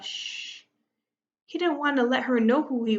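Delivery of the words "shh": soft, drawn-out shush; hushing shh. A narrator's reading. Grandfather hushing Hector on the phone, a soft shush. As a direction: let out a quiet "shh" to silence someone. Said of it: "put a finger before his mouth and let out a".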